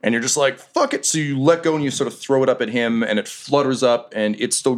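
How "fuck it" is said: resigned exclamation. Acted. Acting player's character giving up.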